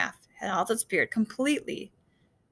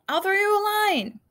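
shouting; 10 semitones higher than usual. A narrator's reading. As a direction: call out across the water. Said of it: "Carter heard Dexter shout".

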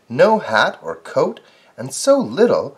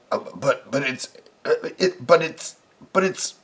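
flustered stammer. Acted. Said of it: "saying over and over".

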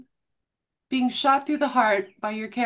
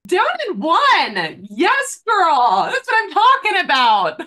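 loud, high, drawn-out cheering; whooping cheer; 6 semitones higher, 6 dB louder, slower than usual. Real, not acted. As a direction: hype up a friend downing a shot. Cheering the guest downing a shot.

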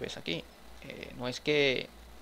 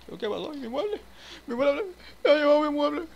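much higher, hammed-up crying wail; mock crying wail; 13 semitones higher, 7 dB louder, faster than usual. Gamer pretending to cry over a vanished cabinet, hammed-up wail.

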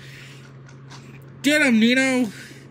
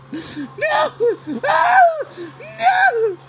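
despairing shouts; 11 semitones higher, much slower than usual. Real, not acted. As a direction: wail the nos as you fall. Losing fight, repeated cries.

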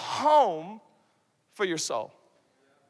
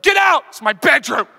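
loud mock yell; snapping teen; 4 semitones higher, 10 dB louder than usual. Preacher mimics a teen yelling at a family member, loud. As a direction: shout them out of your room. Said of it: "you're like".

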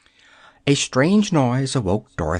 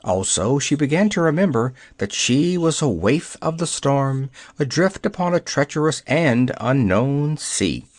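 usual speaking voice

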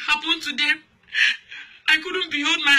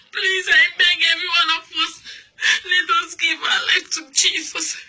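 earnest appeal; 3 semitones higher than usual. Real, not acted. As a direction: beg the congregation sincerely. Closing altar-call appeal.

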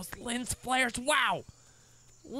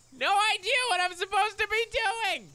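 much higher, louder squeal of helplessness; exasperated; 4 dB louder than usual. Real, not acted. Gamer admitting he has no idea, high exasperated whine. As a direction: whine in mock despair. Lost in the game.

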